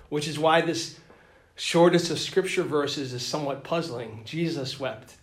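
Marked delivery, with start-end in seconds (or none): none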